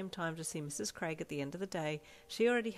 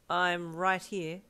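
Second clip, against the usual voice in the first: monotone; 7 dB louder, much slower than usual. A narrator's reading. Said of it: "you monotoned".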